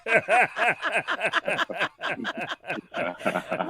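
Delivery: loud laughing reply. Real, not acted. Guest laughing off the Mister Man joke, laughing. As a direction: laugh through the words.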